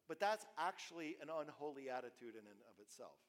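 usual speaking voice

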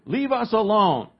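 acted push-away protest; stubborn protest. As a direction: voice people digging in against change. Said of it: "It causes us to resist anything new".

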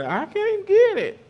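put-on very high, frustrated little-girl voice; whiny little girl. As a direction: whine it like a kid stuck on a puzzle. Father voices stuck young daughter.

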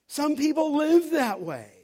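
high, loud preaching emphasis; emphatic. Real, not acted. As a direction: drive it home loudly, stretching the words. Preacher drives point home by repeating.

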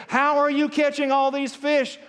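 high, loud frustrated question. Retold or imitated. Ice fisherman asking the kid his secret, frustrated. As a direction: act out a frustrated plea for the secret. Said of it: "he's doubly frustrated".

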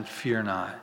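soft and low, gently spoken; tender. Retold or imitated. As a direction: say it like quiet comfort to a frightened man. Jesus gently speaks to Simon.

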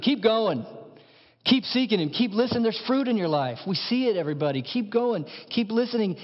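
uplifting pastor's rallying call; rousing exhortation. Real, not acted. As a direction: spur them on to keep going. Exhorting the congregation.

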